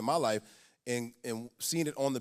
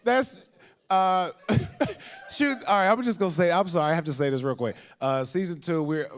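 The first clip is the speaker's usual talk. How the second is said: normal talking voice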